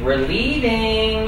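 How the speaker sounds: stalling mock departure. Correspondent pretends to walk out on host, stalling slow announcement. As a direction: stretch it out, waiting to be stopped. Drawn-out mock threat to leave.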